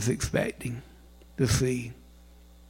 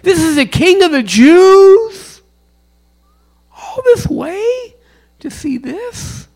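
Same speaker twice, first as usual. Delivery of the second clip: high, loud, whining complaint; exasperated wise men; 11 dB louder than usual. Retold or imitated. Wise men complaining at the humble house, loud whine. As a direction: wail it like a let-down traveller. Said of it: "throw up their hands in the air and go".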